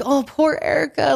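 high sing-song fake sympathy; mock pity. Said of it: "they're like".

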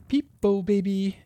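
put-on high, bright peekaboo to a baby; sing-song merry nursery call. A narrator's reading. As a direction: chirp it merrily, pitched well up. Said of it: "said she as merrily as she could".

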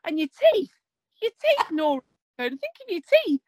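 pleading reprimand, hammed-up; retold telling-off. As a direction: imitate an exasperated owner lecturing the dog. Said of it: "he was telling her off like".

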